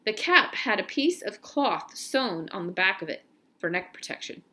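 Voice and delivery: usual speaking voice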